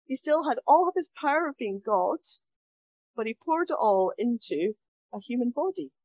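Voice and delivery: ordinary delivery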